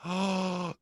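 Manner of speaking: long indrawn gasp; gasp of realisation. Real, not acted. Comic realising his theory, sharp gasp. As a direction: gasp as if struck by an idea.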